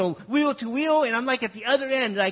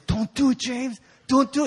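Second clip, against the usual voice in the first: slightly urgent mock warning, raised pitch; lightly voiced friends; 4 semitones higher, 5 dB louder than usual. Friends try to stop the boy's stunt, imitated urgent warning. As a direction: warn him as imitated worried pals. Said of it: "And all my friends".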